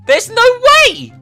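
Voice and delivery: usual speaking voice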